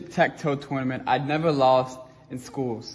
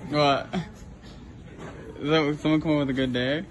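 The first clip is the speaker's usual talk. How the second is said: muffled behind cloth mask; through a face mask; 4 dB quieter than usual. Real, not acted. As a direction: mouth covered by mask. Seen 1: Everyone on screen wears a mask over the mouth; the speaker is unsure.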